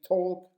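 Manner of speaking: "talk" said with a wide-open, stretched vowel. Shown as a demonstration. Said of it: "open your mouth, and those two vowels sound".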